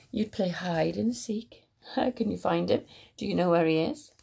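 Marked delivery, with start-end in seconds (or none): none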